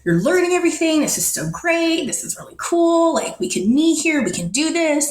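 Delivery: upbeat, lifted quote of a happy man. Retold or imitated. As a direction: imitate someone happily showing you around. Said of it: "he was just so happy".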